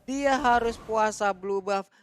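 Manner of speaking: normal talking voice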